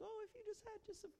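very high, soft orphan begging voice; pitiful beggar. Acted. Preacher mocking a too-polite prayer as a beggar. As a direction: beg like a waif asking for porridge. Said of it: "a little more polite".

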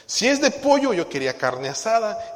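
high, loud whiny complaint; mock complaint. Preacher mocking picky church members, loud high whine. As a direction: whine loudly about the tacos.

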